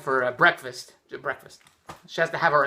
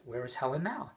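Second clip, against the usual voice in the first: softer, lower, gentle ask; calm question; 6 semitones lower, 13 dB quieter than usual. Retold or imitated. Husband asking his wife quietly. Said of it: "That's why I asked".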